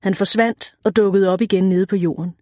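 usual speaking voice

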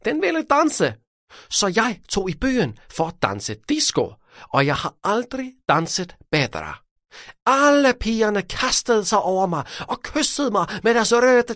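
high, swooping voice of a dance-mad braggart; vain dancing show-off, boasting. Acted. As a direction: relive the wild night with high-pitched, self-admiring relish.

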